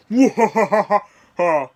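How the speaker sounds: loud, high staccato evil laugh; hammed-up booming wah-ha-ha; villain laugh. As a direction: bellow a theatrical evil laugh.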